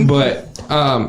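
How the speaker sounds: usual speaking voice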